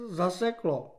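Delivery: high, soft, nervous excuse; small, sheepish voice; timid, skittish. A narrator's reading. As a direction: explain it meekly to the captain. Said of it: "řekl plašivě".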